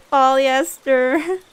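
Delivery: loud, drawn-out word with laughter; amused drawl. Real, not acted. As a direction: stretch it out, tickled by the word. Repeats the funny word, laughing.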